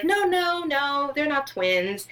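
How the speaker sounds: sweet correction. Retold or imitated. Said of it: "she was like".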